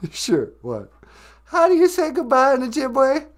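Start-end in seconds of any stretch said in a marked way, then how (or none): 1.4-3.3 much higher, louder silly puppet voice. Acted. Puppet asking the host one more question, high silly voice. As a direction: squeak it out like a cheeky puppet.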